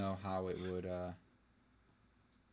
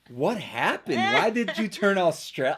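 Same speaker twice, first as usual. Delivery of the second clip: loud, high retold outburst; mock astonishment; 7 semitones higher, 9 dB louder, slower than usual. Teasing friend about the accent slip, mock astonished. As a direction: exclaim in exaggerated disbelief, voice shooting up.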